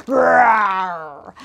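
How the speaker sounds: loud, playful roar in the song; dinosaur roar. Acted. Host roars in the song for the children, loud and playful. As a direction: let out a big hammed-up roar. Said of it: "letting out a roar".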